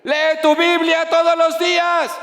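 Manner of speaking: father shouting his last advice to the son at departure; father's parting call. Retold or imitated. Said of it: "la última recomendación del papá fue".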